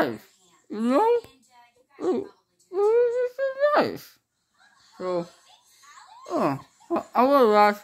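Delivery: talking around mouth packing; muffled speech with gauze. Real, not acted.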